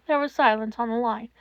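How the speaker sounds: usual speaking voice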